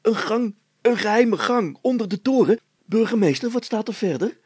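high eager excited voice; eager boy. A narrator's reading. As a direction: bubble over about the secret tunnel. Hannes excited about secret passage. (opgewonden jongen)

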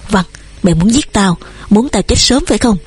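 usual speaking voice

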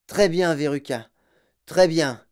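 weary father promising to buy it; placating father. A narrator's reading. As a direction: soothe the spoiled child so she stops. Father placating spoiled daughter.

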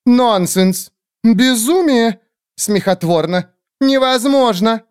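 outraged squeals. Acted. Bone birds protesting the witch's claim, loud high shrieks.

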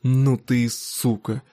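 slow, contemptuous insult; drawn-out venom, without yelling; spat-out insult. A narrator's reading. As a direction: spit the words out slowly. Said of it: "И выплюнул".

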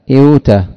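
إيوتا repeated, sliding ee into w; awkward sound. Shown as a demonstration. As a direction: say إيوتا again to show the strain. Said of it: "عسر النطق".

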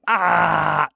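loud, high yell of pain; scream of pain. A narrator's reading. Worker yells as hammer hits his toe, pained scream. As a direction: cry out in wild agony. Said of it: "заорал парень от дикой боли".

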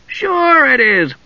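high, drawn-out carefree reply; breezy assurance. A narrator's reading. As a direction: brush off the worry with a high, stretched word. Tass cheerfully reassures worried Usha.

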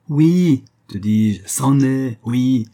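loud, high, emphatic yeses; ringing, excited affirmation; exultant insistence. A narrator's reading. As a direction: shout confirmation with jubilation. Overjoyed confirmation of the gold.

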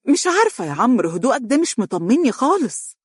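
high-pitched, uneasy doubt; suspicious. A narrator's reading. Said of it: "نظرت إليه بشك وهي تقول".

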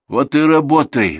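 loud, high, slow retort; drawn-out, worn-down insistence; exasperated sigh. A narrator's reading. Manager answers crying Valentina, exasperated. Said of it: "вздохнул управ".

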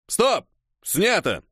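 high, abrupt director's call; commanding. A narrator's reading. Director ending the shot, commanding. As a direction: halt the whole set. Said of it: "скомандовал режиссёр".

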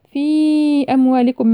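chanted في with a long-held ii before أموالكم; separated elongation. Shown as a demonstration. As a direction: hold the vowel of في for several beats. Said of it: "مد منفصل أربع أو خمس حركات". (مد الياء في كلمة في قبل أموالكم)

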